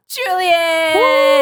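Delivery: high, loud, drawn-out announcer cheer; hype introduction. Real, not acted. Podcast host introducing a co-host, big hyped cheer. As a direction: stretch the name out like a ring announcer.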